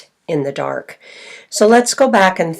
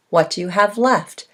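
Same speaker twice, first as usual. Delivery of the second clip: ordinary delivery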